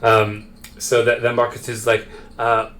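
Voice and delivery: normal talking voice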